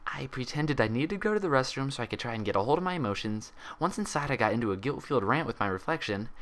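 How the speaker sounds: the patient nervously explaining herself; young mare voice. A narrator's reading. Twilight voiced telling her story.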